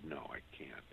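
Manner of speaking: unvoiced whisper, barely audible; clerk's hushed refusal. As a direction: whisper it like a secret no. Clerk refusing, quoted quietly.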